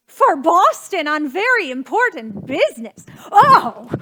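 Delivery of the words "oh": a loud, sudden cry of shock; outraged gasp. Acted. Hostess hearing her guest cancelled, with a loud shocked cry.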